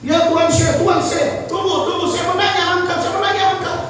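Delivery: put-on high, pleading drunken call; drunk youth calling.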